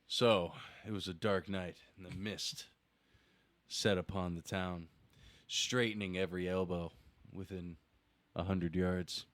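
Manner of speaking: low, measured, solemn storytelling; poetry recital. Acted. Recites a piece of open poetry.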